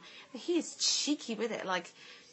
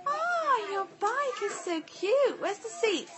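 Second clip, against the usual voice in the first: much higher, louder, gushing over the bike; delighted gushing; 12 semitones higher, 6 dB louder, slower than usual. Real, not acted. Mum gushing over her daughter's bike, excited. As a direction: coo over the little one's bike, slowly.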